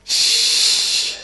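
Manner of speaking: drawn-out mock prophetic "shh"; long loud hush. Mocking a prophet to the congregation, loud hissed hush. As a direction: hush the whole room forcefully.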